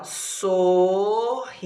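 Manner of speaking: sorridente stretched, so-rri-den-te; syllable by syllable. Shown as a demonstration. Teacher presenting a word to count, syllable by syllable. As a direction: draw out every syllable of sorridente. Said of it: "Quantas sílabas tem?".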